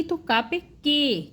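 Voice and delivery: का and के said slowly, ए lengthened in के; teacher showing का becoming के; slow demo syllables. Shown as a demonstration. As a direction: sound out का पे के slowly. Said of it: "मात्रा लगी ए की".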